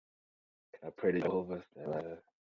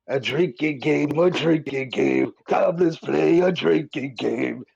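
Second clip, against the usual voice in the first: slow, higher, louder mock drunken chant; fake drunk voice; 4 semitones higher, 5 dB louder, slower than usual. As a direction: ham it up like a tipsy reveler stomping his feet. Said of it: "a fake drunken style".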